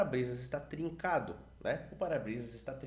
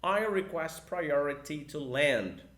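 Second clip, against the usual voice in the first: very slow, louder repeat of the phrase; slower model repeat; 6 dB louder, much slower than usual. Shown as a demonstration. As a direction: repeat it even slower for students to copy. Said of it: "Tudo no um, dois, três".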